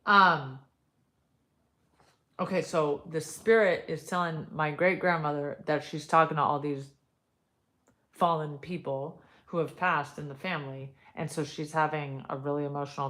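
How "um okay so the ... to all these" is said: ordinary delivery, nothing marked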